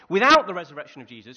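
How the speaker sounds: Without punched out loud and hard; loud emphatic opening. Acted. Sermon climax point.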